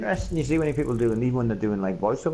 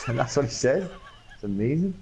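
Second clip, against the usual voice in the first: normal talking voice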